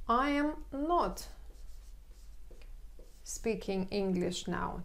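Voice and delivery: slow model of the negative; stress on not. Shown as a demonstration. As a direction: lean on not, then slow the rest. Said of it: "teacher models the negative".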